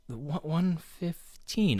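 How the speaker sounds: slow, halting ominous imitation; imitating Lancer's premonition. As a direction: stretch each word as if in a trance. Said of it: "Lancer goes".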